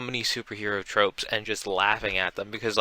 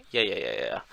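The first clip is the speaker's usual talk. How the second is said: dismissive; 11 semitones lower, 7 dB quieter than usual. Retold or imitated. Tailor brushing off Mark's request.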